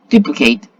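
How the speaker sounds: ordinary delivery, nothing marked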